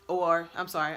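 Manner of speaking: ordinary delivery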